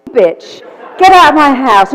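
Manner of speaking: a timid woman's sudden shout; loud outburst. Retold or imitated. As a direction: throw her out with full force. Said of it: "Now say it like you".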